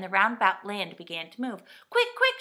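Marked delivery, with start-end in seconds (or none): 1.9-2.4 high, loud urgent shout. A narrator's reading. Fanny shouting to the others to hurry, high and loud. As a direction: yell it as the land swings near. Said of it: "shouted Fanny".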